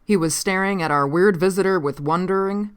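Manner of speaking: usual speaking voice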